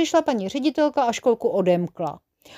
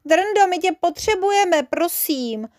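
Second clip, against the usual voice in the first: put-on higher-pitched little-boy plea; coaxing, raised-pitch request; pleading; 7 semitones higher, slower than usual. A narrator's reading. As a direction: beg like a small child, voice lifted. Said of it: "zaprosil Jeroným".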